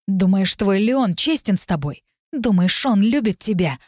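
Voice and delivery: ordinary delivery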